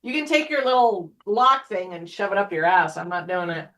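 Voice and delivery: ordinary delivery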